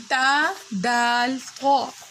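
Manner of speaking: تا and دال each drawn out very slowly; very slow letter recital. Shown as a demonstration. Teacher recites the new lesson letters, very slowly. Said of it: "سبق number تین".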